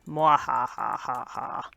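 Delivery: slow evil villain laugh; evil cackle. Pretend. Showing off secret evil lair.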